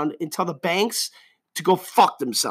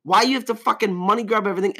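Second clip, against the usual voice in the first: ordinary talk, nothing marked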